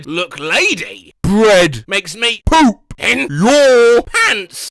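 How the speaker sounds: slow, loud cracked croak; interrupting old woman. Retold or imitated. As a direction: cut him off, ranting. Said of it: "she interrupted".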